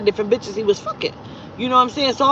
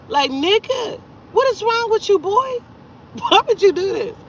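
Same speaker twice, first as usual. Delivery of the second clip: very high-pitched exasperated reproach; incredulous scolding; 11 semitones higher than usual. Retold or imitated. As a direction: scold him in disbelief, voice way up high. Retold scolding of the man.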